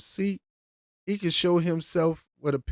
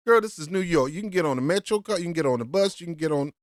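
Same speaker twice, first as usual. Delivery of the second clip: ordinary talk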